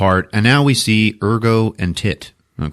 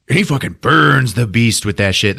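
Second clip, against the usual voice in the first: ordinary talk, nothing marked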